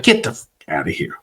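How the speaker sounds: hushed disbelief. Retold or imitated. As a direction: say it under your breath, dumbfounded. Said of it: "and I was like".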